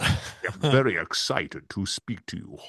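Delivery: slow, measured masked villain; ponderous movie-villain voice; Darth Vader. Acted. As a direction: intone it slowly and gravely, breath after it. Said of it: "we need a bit of Darth Vader".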